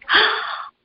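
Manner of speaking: sharp, high, breathless gasp of amazement; amazed gasp. Retold or imitated. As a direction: catch your breath in sudden wonder. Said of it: "I was like".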